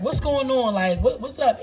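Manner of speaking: loud, what's going on?; confused shout. Retold or imitated. Crew at the door demanding answers, loud. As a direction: call it out loud and puzzled.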